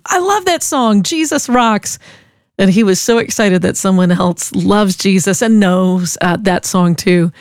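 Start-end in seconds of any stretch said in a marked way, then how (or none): 0.1-2.0 retold yell. Listener yelling over to the weed-whacking guy, retold high-pitched yell. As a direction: call it over in a raised pitch, imitating a yell. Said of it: "I yelled over to him".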